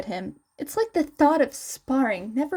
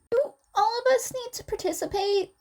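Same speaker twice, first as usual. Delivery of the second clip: high, fast eager question; eager student; 11 semitones higher, faster than usual. Acted. Izuku asking the teacher about the festival, high and fast. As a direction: ask it quickly, voice pitched up.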